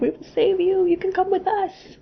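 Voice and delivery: high, lightly hammed-up savior voice; mock heroic rescuer. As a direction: play the would-be rescuer from a movie. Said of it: "be like".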